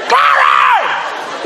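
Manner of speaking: high, long-held holler; drawn-out praise yell; shout. Retold or imitated. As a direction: stretch it into a high street shout. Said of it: "So I just began to shout".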